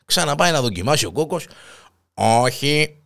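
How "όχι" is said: lightly voiced machine no; a hint of a robot refusing. Robot blocks the old man again, light robot voice. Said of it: "Ρομπότ φωνή, να πούμε".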